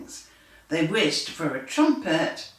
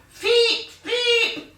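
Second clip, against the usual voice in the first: very high loud whistle sound; 5 dB louder than usual. A narrator's reading. Mouse referee blowing a very loud whistle. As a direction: blow a shrill ref whistle. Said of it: "a very loud whistle".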